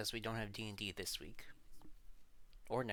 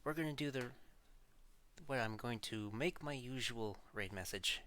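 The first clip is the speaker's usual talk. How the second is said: normal talking voice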